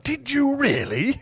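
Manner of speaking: high, slow, drawn-out question; delighted curiosity. Acted. Father hearing of the car trip, secretly delighted. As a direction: marvel at it softly with rising glee.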